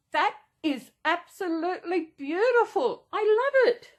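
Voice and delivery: delighted squeal. Real, not acted. Holding up the blanket for viewers, squealing with delight. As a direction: squeal with joy at it.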